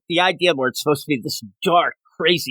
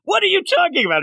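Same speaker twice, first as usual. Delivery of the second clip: mock angry Grundy; faster than usual. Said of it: "He's gonna get angry".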